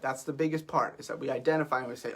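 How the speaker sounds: usual speaking voice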